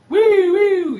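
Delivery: high, loud whooping cry; excited whoop. Retold or imitated. Racing thoughts behind the wheel, loud high whoop. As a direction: whoop like your thoughts are flying.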